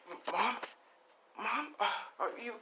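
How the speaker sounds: put-on a scared child checking on mom; frightened. Son Izuku at his mother's bedside, high and scared.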